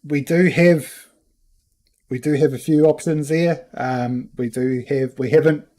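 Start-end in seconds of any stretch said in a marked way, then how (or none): none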